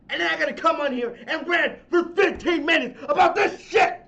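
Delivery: loud, high, furious outburst; real angry shouting. Real, not acted. Podcast host fuming over Goldberg booking, shouting. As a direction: erupt in genuine rage. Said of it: "I know I'm angry".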